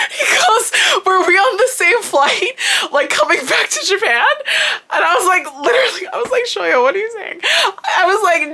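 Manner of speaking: high-pitched, giggly retelling through real laughter; laughing while talking. Real, not acted. Retelling a friend's silly question about the flight, laughing. Telling the story while cracking up.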